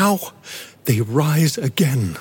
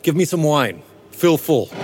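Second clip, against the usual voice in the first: usual speaking voice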